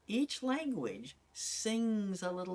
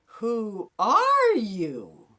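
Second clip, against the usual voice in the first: very slow, far louder and higher, leaning on "are"; dramatic; 4 semitones higher, 12 dB louder, much slower than usual. Shown as a demonstration. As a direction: hurl the question out. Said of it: "Let's try it another way".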